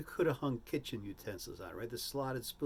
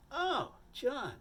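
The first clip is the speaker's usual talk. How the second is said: quoting; 13 semitones higher than usual. Retold or imitated. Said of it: "he says".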